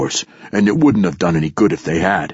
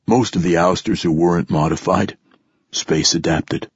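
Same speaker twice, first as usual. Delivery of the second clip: plain reading voice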